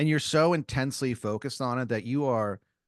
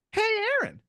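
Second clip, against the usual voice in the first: very high squeaky cartoon voice, building louder; cartoon pickle calling out; 10 semitones higher, 7 dB louder, slower than usual. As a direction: call up to him as a tiny pickle, stretching the last word. Grinning cartoon pickle calls to diner.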